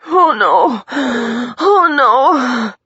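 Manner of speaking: loud, high moaning cry; panicked wail. A narrator's reading. Injured woman realizing she may die, panicked wail. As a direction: wail it in rising despair.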